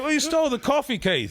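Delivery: high-pitched mock telling-off; exasperated scolding. Telling Keith off for stealing church coffee, high exasperated protest. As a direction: protest incredulously, dropping on the name.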